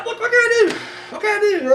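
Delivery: high-pitched feigned helpless plea; mock helplessness. Excusing himself over Father McClanahan, mock helpless.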